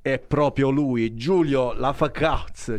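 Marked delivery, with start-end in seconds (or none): 1.2-2.8 slow mock-English pronunciation; finto accento inglese. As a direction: say the name as if it were English, drawn out. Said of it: "lo dico in maniera, eh, inglese". (finto inglese, scandito lentamente)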